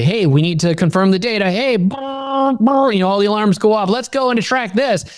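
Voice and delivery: high, excited alarm voice; high excited mind shouting alarms; alarm excitement. Retold or imitated. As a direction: sound the alarm, buzzing with urgency.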